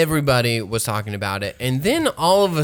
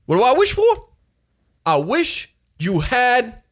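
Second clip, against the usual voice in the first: acted movie line; 5 semitones higher than usual. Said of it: "And then he walks away and he blows up".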